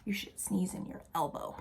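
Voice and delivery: gentle whispered reminder; soft, secret-sharing whisper. Real, not acted. Hushed advice to children.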